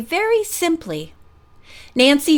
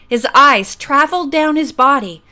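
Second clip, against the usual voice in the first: ordinary delivery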